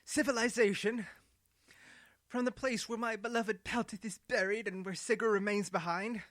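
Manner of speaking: cling to her lost home; bitter Ado. Acted. Ado's voice in the story.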